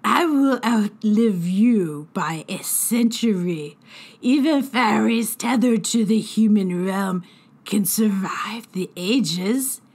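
slow, crackling sprite, whispering the end; old fairy boasting of her long life; scornful fairy hag. Acted. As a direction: scoff at the human's threat. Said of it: "the healer laughed".

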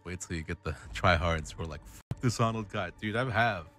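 normal talking voice

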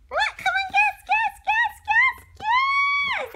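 very high, loud repeated guess; little bunny pleading. Acted. Little bunny begging dad to guess, very high and loud. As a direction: squeal it like an impatient little one.

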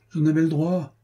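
high-pitched plaint of a put-upon squire; lightly voiced groaning servant; mild sighing complaint. A narrator's reading. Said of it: "soupira l'écuyer".

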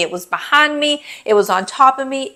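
usual speaking voice

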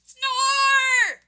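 loud, high shouted "snore"; full-volume cartoon snore; huge snore. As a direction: belt out one long, booming snore word. Huge snore wakes the other.

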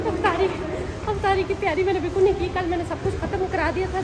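oh no, I haven't done it at all; a panicked realization that she hasn't prepared anything for iftar; sudden alarmed realization. Real, not acted.

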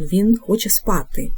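ordinary talk, nothing marked

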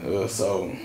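normal talking voice

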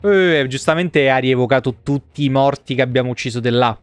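ordinary delivery

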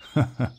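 a short chuckle at the tiny sword; amused laugh. Acted. Barbarian chuckles at the goblin calling its knife a sword, amused laugh.

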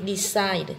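"decide" said slowly, stressed on CIDE; stress on second syllable. Shown as a demonstration. Teacher reading answer options, "decide" stressed on CIDE. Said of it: "nhấn vào âm hai".

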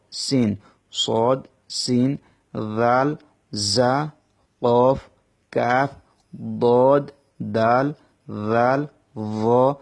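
slow, careful Arabic letters; letter drill. Shown as a demonstration. Teacher drilling the Arabic letters for students, slow. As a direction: sound out each letter slowly for students. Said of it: "অক্ষরগুলো practice করে নেবেন".

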